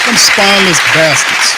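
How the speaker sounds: loud, high, furious yell; angry shout. Real, not acted. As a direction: blast them with contempt. Angry rant at reporters.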